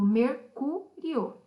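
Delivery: mercúrio slowly split, with CÚ punched; syllable stress demo. Shown as a demonstration. Teacher showing pupils the strongest syllable, stressed syllable demo. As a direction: pull mercúrio apart syllable by syllable, leaning on CÚ. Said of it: "a sílaba mais forte é o cu".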